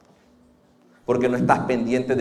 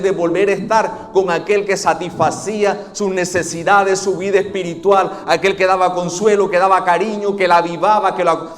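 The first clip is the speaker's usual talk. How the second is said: raised, ringing preacher's voice, higher and louder; fervent preaching; 6 semitones higher, 7 dB louder than usual. Acted. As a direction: preach it with fire to the congregation. Preaching at full force.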